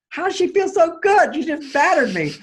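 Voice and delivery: high, loud disbelieving question; can't believe what he's hearing; incredulous. Retold or imitated. Husband stunned by wife's mood.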